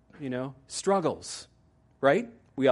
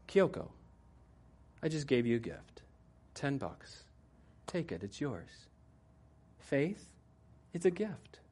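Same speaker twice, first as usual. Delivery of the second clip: soft, patient voice of the campus minister; gentle minister; 2 semitones higher, 5 dB quieter than usual. Retold or imitated. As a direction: offer it kindly, much softer. Minister gently offers the gift.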